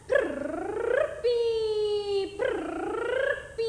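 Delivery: drawn-out whistling snore sound; snoring through a whistle. A narrator's reading. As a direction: stretch the snore into a shrill whistle. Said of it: "नानी टक्कू बराबर".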